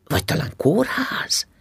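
ordinary delivery

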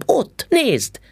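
excited call. A narrator's reading. Child spots man in pub.

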